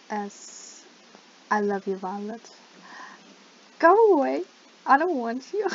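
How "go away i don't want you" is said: high, loud protest with swooping pitch; loud shooing. Real, not acted. Gamer chasing off another player, loud high-pitched protest. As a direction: shoo them off at full volume, voice leaping up.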